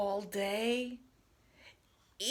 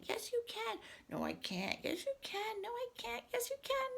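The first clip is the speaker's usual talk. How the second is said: expressive read-aloud with swooping pitch; animated picture-book dialogue; 3 semitones higher than usual. Acted. Reader voices two friends arguing over pretending, animated. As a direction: act out both characters for young children.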